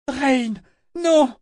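high, loud anguished cry; scream. A narrator's reading. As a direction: scream her name in horror. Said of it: "s'écria-t-elle".

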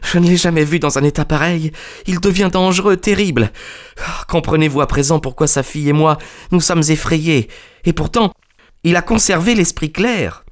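high-pitched, agitated confiding; frightened collaborator. A narrator's reading. Bennett confides his fear. (collaborateur effrayé, voix haut perchée)

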